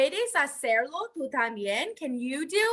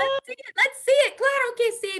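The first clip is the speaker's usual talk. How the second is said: high, bright encouragement; excited; 11 semitones higher than usual. Acted. Teacher urges children to wave their arms, high excited. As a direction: egg the kids on, high pitch. Cheering kids on.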